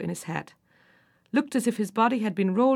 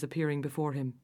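ordinary delivery, nothing marked

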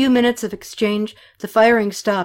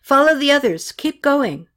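higher, louder urgent calls; urgent direction; 6 semitones higher, 4 dB louder than usual. A narrator's reading. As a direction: herd them along with raised voice. Directing fleeing people to safety.

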